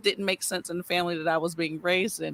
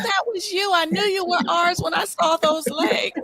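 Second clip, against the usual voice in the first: light, excited aunt quote; imitating aunt; 12 semitones higher, 4 dB louder than usual. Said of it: "and she said".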